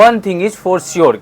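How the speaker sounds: slow, careful model sentence; slow model line. Shown as a demonstration. Teacher models the line slowly for students. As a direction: lay it out word by word. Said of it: "क्या बोलेंगे?".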